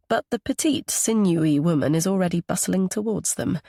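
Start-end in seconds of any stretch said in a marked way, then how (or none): none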